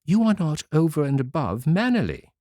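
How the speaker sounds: put-on fairy calmly scolding the girl. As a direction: rebuke without anger. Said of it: "without putting herself in a passion".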